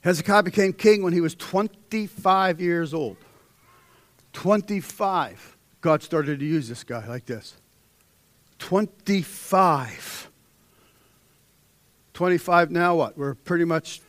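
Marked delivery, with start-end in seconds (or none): 4.3-5.6 slow, weighted "Twenty-five"; drawn out. Acted. Preacher stressing Hezekiah's young age, slowly. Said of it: "repeats the age slowly". 8.6-10.3 slow, weighted "Twenty-five"; drawn out. Acted. Preacher stressing Hezekiah's young age, slowly. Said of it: "repeats the age slowly".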